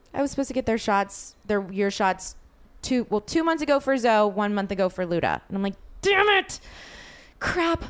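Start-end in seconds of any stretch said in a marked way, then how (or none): 6.0-7.8 high-pitched mock exasperation; hammed-up burst of frustration; retold frustrated cursing. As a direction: curse your own forgetfulness, pitched high. Acts out frustration over forgotten shots.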